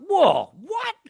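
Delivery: high, squeaky startled whoa; mock disbelief. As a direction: fake shocked outburst in a silly voice. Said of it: "And I was just like".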